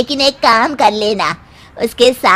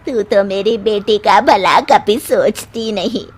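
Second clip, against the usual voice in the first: ordinary talk, nothing marked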